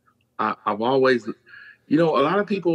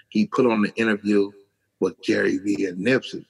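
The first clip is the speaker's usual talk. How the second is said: ordinary delivery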